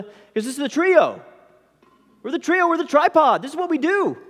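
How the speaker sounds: high, loud indignant whine as Peter. Retold or imitated. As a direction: play Peter protesting he's part of the trio. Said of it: "He's like".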